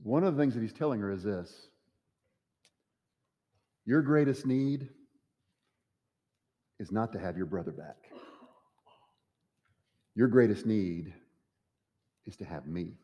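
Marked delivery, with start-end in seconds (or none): 3.8-8.1 gentle assurance. Acted. Jesus telling Martha gently. 10.1-13.0 tender assurance. Acted. Jesus telling Martha gently.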